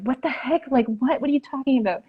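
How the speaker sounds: high-pitched retold disbelief; freaked out. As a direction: imitate someone thrown and incredulous. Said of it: "got freaked out 'cause she was like".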